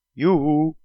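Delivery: high and loud yoo-hoo from afar; sing-song call to lure him back. Acted. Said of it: "klonk het alweer over het water".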